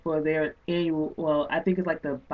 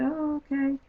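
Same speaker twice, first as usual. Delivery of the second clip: higher, mock offhand oh okay; lightly voiced bored acceptance; dismissive okay; 9 semitones higher than usual. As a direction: imitate a polite brush-off. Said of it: "put it down and say".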